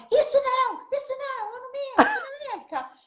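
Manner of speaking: very high, strained indignant protest; mock furious policeman. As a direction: squeal it like an outraged cop. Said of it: "ele ficou louco". (imitação de policial furioso, voz muito aguda)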